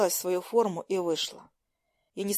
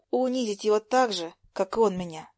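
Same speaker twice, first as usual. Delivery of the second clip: usual speaking voice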